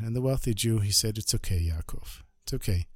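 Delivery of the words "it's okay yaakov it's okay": soft, low and kind, easing his shame; a hushed, reassuring host; gentle reassurance. Acted. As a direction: comfort him quietly. Host comforts embarrassed guest.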